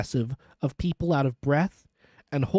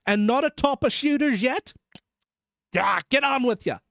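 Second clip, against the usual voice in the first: higher-pitched, vexed urging; vexed coachman; 7 semitones higher than usual. A narrator's reading. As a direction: chivvy the horses in vexation. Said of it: "ejaculated the vexed coachman".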